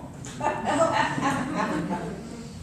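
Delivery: high breathy laughter; real laugh. Real, not acted. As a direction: laugh at your own joke. Laughs at own joke.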